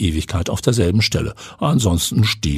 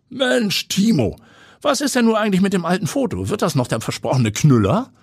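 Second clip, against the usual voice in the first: pushy boss voice; 12 semitones higher than usual. A narrator's reading. Editor-in-chief pushes Timo.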